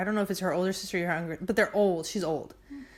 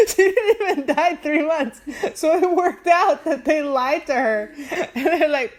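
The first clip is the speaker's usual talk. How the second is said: high, loud, through laughter; barely containing the laughs while telling it; laughing; 7 semitones higher, 7 dB louder than usual. Real, not acted. Laughing at her own plot twist.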